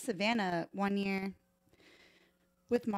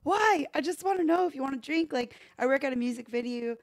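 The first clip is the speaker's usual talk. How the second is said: indignant; 3 semitones higher, 4 dB louder than usual. Retold or imitated. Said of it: "And I was like".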